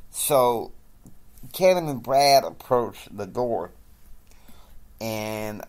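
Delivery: ordinary talk, nothing marked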